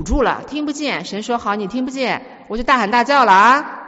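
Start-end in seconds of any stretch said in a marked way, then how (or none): 1.4-3.7 acted loud warning, building in volume; God shouting. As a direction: raise the voice at someone who won't listen. (模仿神提高嗓门大喊)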